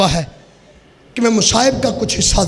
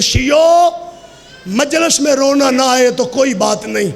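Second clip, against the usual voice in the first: higher, slowed plea to the mourners; raised preaching; 4 semitones higher, slower than usual. Acted. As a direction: call out the Imam's plea. Imam's plea, raised chanting. (ऊँचे सुर में धीमी पुकार)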